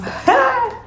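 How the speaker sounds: a burst of high giggling; high delighted laugh. Real, not acted. Giggling at the box, a high delighted laugh.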